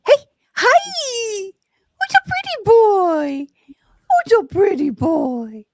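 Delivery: high slow cooing; sing-song pet talk. Real, not acted. Cooing at the dog.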